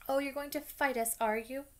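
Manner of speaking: high mocking sneer; a sneer daring them to fight. A narrator's reading. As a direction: taunt them with a high jeer. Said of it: "Malfoy sneered".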